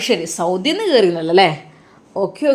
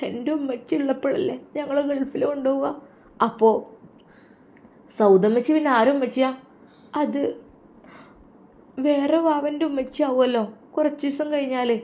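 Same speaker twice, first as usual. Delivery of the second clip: put-on little girl speaking through tears, softer; crying child; 5 semitones higher, 3 dB quieter than usual. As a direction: daughter tearfully missing her mother. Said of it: "മോളെന്താ കരയണത്?". (കരഞ്ഞുകൊണ്ട് ചെറിയ മകൾ)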